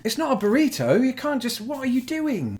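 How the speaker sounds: can't believe what he's seeing; incredulous protest. Real, not acted. Protesting the folded pizza.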